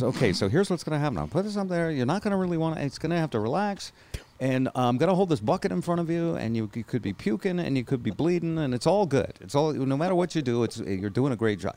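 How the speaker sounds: lightly voiced cheerful doctor selling the ordeal; upbeat pitch man. Doctor to patient before the tube, breezy pitch-man voice. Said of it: "And he goes".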